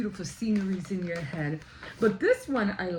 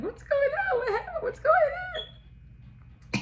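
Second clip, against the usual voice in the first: high, squealing mock alarm through laughter; hammed-up panicked shout cracking with laughs; slower than usual. Coworkers rushing to the jammed door, mock panicked.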